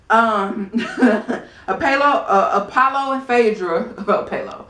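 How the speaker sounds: giggling through the names; can't stop laughing. Real, not acted. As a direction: laugh while trying to restart. Laughing while resuming.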